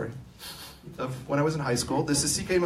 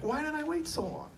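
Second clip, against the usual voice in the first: amazed at himself; 14 semitones higher, 4 dB quieter than usual. Retold or imitated. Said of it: "I'm like".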